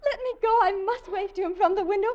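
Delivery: high, anxious pleading; frantic. Acted. Wife frightened, begging to answer the ghost's call.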